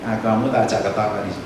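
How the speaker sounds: ordinary delivery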